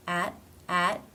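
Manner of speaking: AT cut off with no T release; stop T. Shown as a demonstration. Teacher modelling a word ending, stop T in AT. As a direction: hold back the final T of AT. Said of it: "pronounced as a stop".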